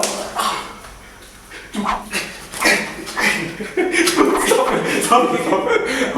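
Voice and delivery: breathless laughing protest. Real, not acted. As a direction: giggle through the words.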